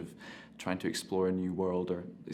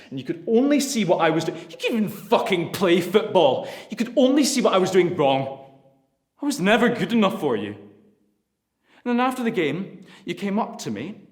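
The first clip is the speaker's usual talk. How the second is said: louder and higher, yelling at his father; furious shout; 12 semitones higher, 12 dB louder than usual. Acted. Son screaming at his father, furious. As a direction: let the old grievance explode.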